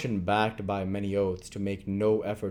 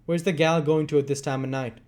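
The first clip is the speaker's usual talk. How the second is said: demanding; 6 semitones higher, 5 dB louder than usual. Acted. Sikes challenging Nancy leaving.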